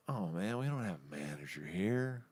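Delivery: low, soft, lazy bar worker; mumbling bar worker. Retold or imitated. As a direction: mumble it like you don't care. Bar staff voiced in story.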